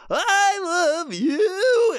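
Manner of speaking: very high, drawn-out dinosaur wail; weak, squealing mock affection; skinned Barney. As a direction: stretch it out like a kids' TV dinosaur in agony. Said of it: "like, Barney the dinosaur that was skinned".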